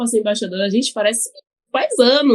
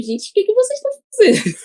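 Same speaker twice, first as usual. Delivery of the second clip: mock bewildered exclamation; 9 semitones higher than usual. Mock reaction to people's double meanings.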